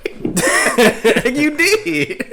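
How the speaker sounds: high, laughing squeal. Real, not acted. Laughing at friend's line.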